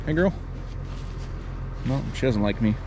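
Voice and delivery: normal talking voice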